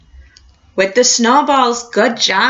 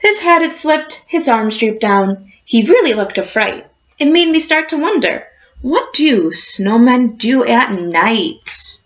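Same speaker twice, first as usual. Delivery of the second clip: put-on reading to children. A narrator's reading. Teacher reading a picture book to her class, storybook voice.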